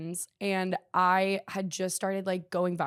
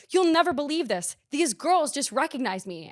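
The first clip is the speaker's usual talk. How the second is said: higher, eager telling of big news; louder excited report; 6 semitones higher, 4 dB louder than usual. Retold or imitated. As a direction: burst out with the good news. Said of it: "I go".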